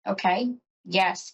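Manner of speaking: ordinary talk, nothing marked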